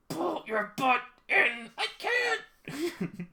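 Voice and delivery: high slow pleading squeak; high squeaky dub. Pretend. Dubbing the manga argument in the stall, high squeak. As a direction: squeal it in mock panic.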